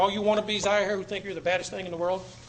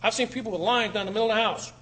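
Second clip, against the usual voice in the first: ordinary talk, nothing marked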